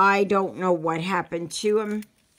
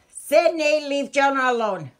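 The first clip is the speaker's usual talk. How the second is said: loud, high call across the house; 7 semitones higher, 5 dB louder than usual. Real, not acted. Calls out to a child.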